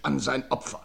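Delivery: normal talking voice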